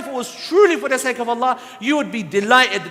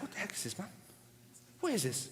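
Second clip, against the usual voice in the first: much softer, near-breath aside; annoyed inner thought; 3 semitones lower, 18 dB quieter, faster than usual. Retold or imitated. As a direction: grumble it under your breath, irritated.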